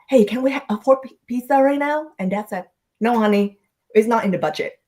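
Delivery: ordinary talk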